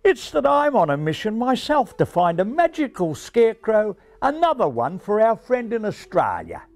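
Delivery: high-pitched Australian proclamation; high, emphatic Australian pigeon; Australian announcement. Acted. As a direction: announce it proudly in an Aussie accent. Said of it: "the Australian pigeon announced".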